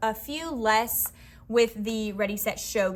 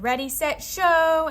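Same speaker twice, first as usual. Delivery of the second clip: game call; 5 semitones higher, 7 dB louder, slower than usual. Shown as a demonstration. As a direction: show called out loud and high. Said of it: "I said".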